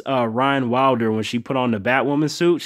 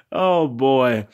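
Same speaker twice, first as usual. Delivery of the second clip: usual speaking voice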